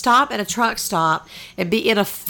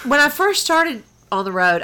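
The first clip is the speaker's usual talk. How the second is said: ordinary talk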